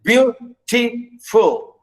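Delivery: "beautiful" slowly split into beau, ti, ful; beau... ti... ful, for the class; syllable by syllable. Shown as a demonstration. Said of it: "stress the first".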